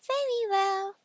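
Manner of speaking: high squeaky pig voice; eager little pig. Acted. The pig agrees.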